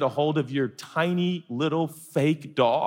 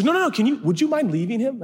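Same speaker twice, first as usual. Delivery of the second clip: high, eager woman's voice; higher-voiced insistent lady; lady stranger; 7 semitones higher, faster than usual. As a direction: hurry to reassure, pitched up. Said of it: "She says".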